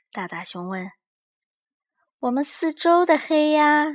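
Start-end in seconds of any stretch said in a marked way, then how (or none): 2.2-3.9 high, louder little cub voice; small cub pleading about the dark; Little Bear voice. A narrator's reading. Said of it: "小小熊说。".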